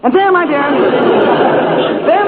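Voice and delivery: ordinary delivery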